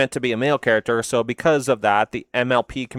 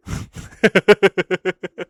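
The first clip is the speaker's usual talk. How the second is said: high burst of laughter; 8 semitones higher, 5 dB louder than usual. Real, not acted. Laughing at the pun.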